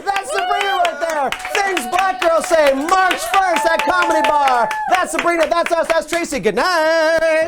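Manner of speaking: high, loud announcer's shout over applause; rousing show sign-off. Real, not acted. Host thanking performers and closing show, rousing loud sign-off. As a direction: project the names to the whole room.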